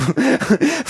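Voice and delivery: high, sudden laugh; high burst of laughter. Real, not acted. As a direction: burst out laughing at your own joke. Laugh after bit.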